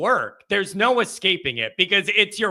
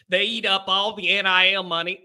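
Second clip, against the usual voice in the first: ordinary talk, nothing marked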